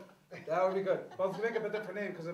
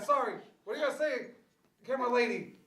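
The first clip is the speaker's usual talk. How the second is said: usual speaking voice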